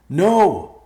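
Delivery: very loud, high yell; high-pitched cry from below. Acted. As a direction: shout an urgent warning. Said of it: "Evercloud yelled".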